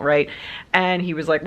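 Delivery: ordinary delivery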